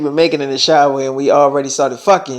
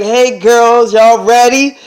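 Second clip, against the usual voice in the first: acted-out loud, high call to friends; excited hailing; 5 semitones higher, 5 dB louder, much slower than usual. Selena greets her waiting friends, excited hailing. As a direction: hail the girls, pitched up.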